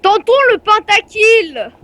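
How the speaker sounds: loud, slow hyped battle cry; rally for the big play; excited call. Real, not acted. Lance un défi en pleine partie. (cri enthousiaste et appuyé)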